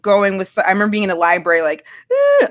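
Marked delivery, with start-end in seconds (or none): 2.1-2.5 high-pitched "eh" of misery; mock upset whine. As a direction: imitate a brief, high whimper. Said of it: "upset about the breakup".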